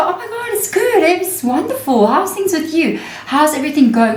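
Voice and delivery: very high, bubbly mock delight; gushing happy reply. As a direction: gush like everything is wonderful. Acts a gushing happy person.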